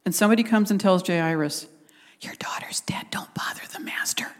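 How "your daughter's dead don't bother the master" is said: hushed, secretive whisper of bad news; acted messenger's whisper. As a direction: whisper it into someone's ear. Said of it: "Comes and whispers in Jairus' ear".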